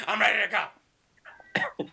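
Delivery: put-on slow, high, strained old-warrior voice; mock old warrior. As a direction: play the unfit warrior straining to report for duty. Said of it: "You're like".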